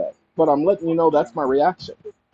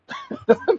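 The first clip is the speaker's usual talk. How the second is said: laugh. Real, not acted. Laughing at dog's name.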